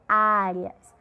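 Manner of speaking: "áreas" isolated, weight on first "á"; stress on A. Shown as a demonstration. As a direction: lean on the opening of "áreas". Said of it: "A sílaba de maior força é o A".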